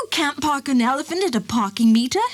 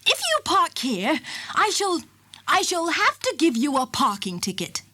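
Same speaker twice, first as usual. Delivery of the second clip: annoyed; 6 semitones higher than usual. A narrator's reading. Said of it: "She began to get annoyed".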